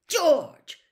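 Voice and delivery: loud, high, cutting call of a name; sharp shout. A narrator's reading. Mother catches her son at breakfast, sharp loud shout. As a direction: bark the name to make everyone jump. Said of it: "said Missus Weasley sharply".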